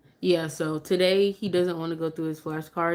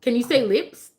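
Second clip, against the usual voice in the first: higher, louder coaxing question; coaxing teacher; 5 semitones higher, 5 dB louder than usual. Shown as a demonstration. Mom coaxing her baby to say lips. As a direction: coax him to repeat the word. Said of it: "work on flashcards with him".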